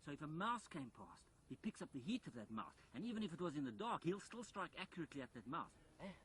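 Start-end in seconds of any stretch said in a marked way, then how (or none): none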